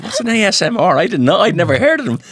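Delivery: puzzled surprise. Retold or imitated. To the person who mentioned ASMR, baffled. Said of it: "and I was like".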